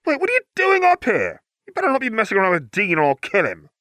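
sharp, rising sibling snap; a jumpy brother snapping at his sister; snapped. Acted. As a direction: snap at her like a protective big brother. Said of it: "Ron snapped".

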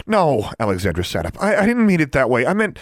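high, loud, stumbling denial; flustered protest. A narrator's reading. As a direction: rush to take back the hurt. Hurried protest after hurting her sister.

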